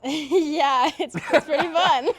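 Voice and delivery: high, loud, laughing sarcasm; sarcastic. Real, not acted. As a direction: laugh through obviously overdone enthusiasm. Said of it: "that sounds so sarcastic".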